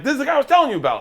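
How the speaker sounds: crew reaction. Retold or imitated.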